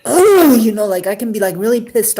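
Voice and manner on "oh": loud, high burst of anger; mock angry oh. Imagining getting mad at a friend, loud angry Oh. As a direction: snap out a mad Oh. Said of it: "get mad at you and say".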